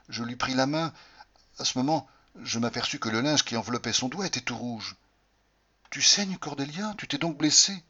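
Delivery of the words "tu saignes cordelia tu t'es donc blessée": worried at the blood; alarmed concern. Acted. Découvre le doigt en sang. (inquiétude alarmée, plus haute et lente)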